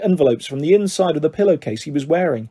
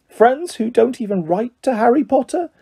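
high, shy house-elf voice; Dobby, shy; 6 semitones higher than usual. A narrator's reading. Elf slyly questions Harry about his friends, shy and high. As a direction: ask it timidly, pitched up. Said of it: "said Dobby shyly".

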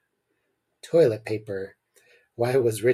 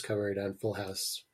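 whispered aside; 3 semitones lower, 11 dB quieter than usual. Real, not acted. Aside to a friend about a TV show, whispered. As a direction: drop to a whisper.

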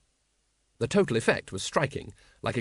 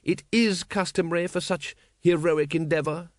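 a little testy; 5 semitones higher than usual. Acted. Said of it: "a little testily".

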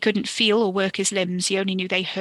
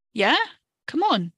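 calls down from above, high and urgent; calling out; 6 semitones higher, 3 dB louder than usual. A narrator's reading. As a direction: shout up the dark stairwell. Wes calling down the stairs.

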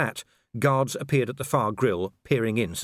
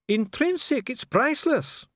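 much higher, indignant astonishment; affronted disbelief. Acted. Official answers visitor doubting the relic, affronted. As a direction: balk at the very question. Dee affronted by Vimes's question.

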